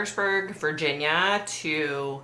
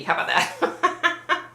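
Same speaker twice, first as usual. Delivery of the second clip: laughing; 12 semitones higher, slower than usual. Real, not acted. Laughs after correcting rude word.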